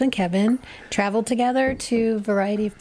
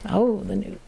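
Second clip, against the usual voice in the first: ordinary talk, nothing marked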